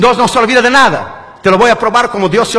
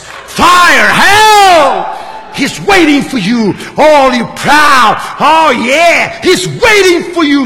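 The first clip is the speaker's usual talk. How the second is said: drawn-out, swooping shouts; hammed-up hellfire menace; taunting hellfire warning; 3 semitones higher, 3 dB louder, much slower than usual. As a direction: stretch each threat out slowly and theatrically. Preacher menaces man with hell.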